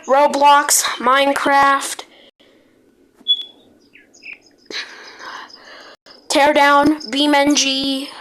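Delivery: news-anchor alert read, steady and formal; mock emergency broadcast. Gamer announcing a pretend disaster to the server, announcer voice.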